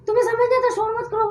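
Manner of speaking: high-pitched exasperated scolding; सबको डाँटना. Retold or imitated. Retold scolding of the whole group. (ऊँची आवाज़ में झुंझलाकर डाँटना)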